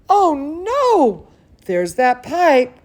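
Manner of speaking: usual speaking voice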